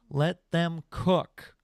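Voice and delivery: very slow, stretched catchphrase; slow drawn out. Pretend. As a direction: stretch every word out. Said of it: "catchphrase drawn out".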